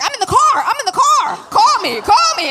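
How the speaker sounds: high, loud panicked yelling; retold scream. Angie on the phone, screaming she's in the car. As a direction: shriek it like you're driving in shock. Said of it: "Angie screams".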